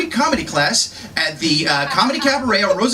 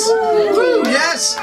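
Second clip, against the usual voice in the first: cheer; 11 semitones higher than usual. Real, not acted. Cheering for the ladies.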